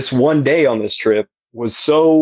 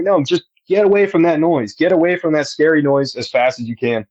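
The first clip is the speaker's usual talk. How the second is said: ordinary delivery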